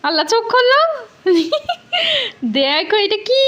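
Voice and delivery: put-on high, excited build-up for small kids; sing-song surprise countdown for little ones; reading to a toddler.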